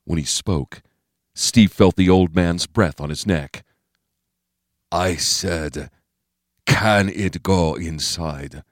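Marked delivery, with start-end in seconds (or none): 4.9-8.6 put-on very slow, accented old-man voice; quiet menace. A narrator's reading. Bo Pan gripping Steve's shoulder, slow menacing voice. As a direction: draw each word out as a controlled threat.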